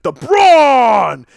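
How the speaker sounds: loud booming ring call; entrance music call. Retold or imitated. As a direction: belt it like the entrance theme. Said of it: "impression of the Sting that opens up Braun's entrance music".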